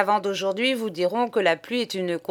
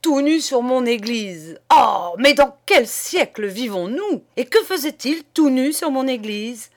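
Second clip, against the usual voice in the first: loud, raised outraged tirade; furious; 4 semitones higher, 7 dB louder than usual. Acted. Priest raging about the naked man on his church, furious. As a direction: spit the words out in scandalized fury. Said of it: "s'empourprait de colère, râpait les mots".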